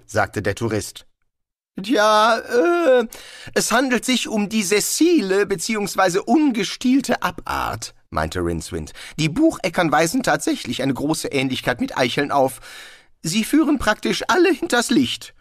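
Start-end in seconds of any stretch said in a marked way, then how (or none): 1.8-7.9 high, loud, hedging wizard; bluffender Zauberer. A narrator's reading. Wizard bluffs about the tree. 9.2-15.3 high-pitched know-it-all wizard; bluffender Zauberer Rincewind. A narrator's reading.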